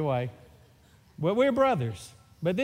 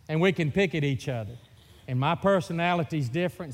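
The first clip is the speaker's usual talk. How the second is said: normal talking voice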